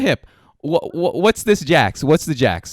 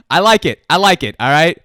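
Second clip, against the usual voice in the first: laughing; 4 semitones higher, 6 dB louder than usual. Real, not acted. Laughing at funny answer.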